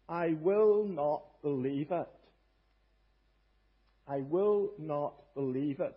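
slow, weighted stress on WILL NOT; very emphatic. Acted. Preacher voicing the doubting disciple's refusal, very emphatic. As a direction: lean hard on "will not", drawn out slowly. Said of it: "very emphatic".

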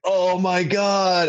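high, long-stretched mock dismay; drawn-out exclamation, pitched far up; mortified realization. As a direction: imitate a horrified dawning realization, slowly. Retold dawning realization after the interview.

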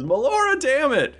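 high, strained oath; exasperated guard. Acted. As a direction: swear by the goddess in exasperation. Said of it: "he goes".

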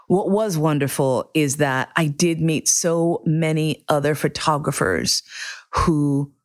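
normal talking voice

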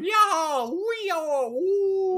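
high, loud mob whoop; mock rowdy yell from men in a purge. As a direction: whoop like a rioting crowd. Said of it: "men running through going".

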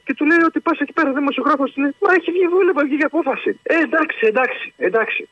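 high, agitated storytelling; worked-up retelling. Real, not acted. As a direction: get riled up telling it. Caller excitedly retelling.

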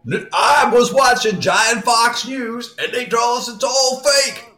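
high-pitched mock Southern giant; Southern accent. Giant citing Giant Fox News to spouse, high mock Southern accent. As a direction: insist on it in a put-on Southern accent. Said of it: "this giant has a Southern accent".

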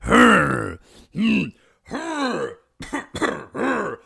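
short rough grunts to warm up the voice; morning throat-clearing grunts. Shown as a demonstration. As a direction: get the engine started with a few grunts. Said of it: "you just have to go like".

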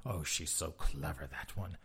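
hushed mock admiration; lightly whispered sarcasm; sarcastic whisper. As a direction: sneer it softly.

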